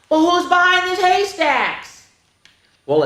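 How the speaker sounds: ordinary delivery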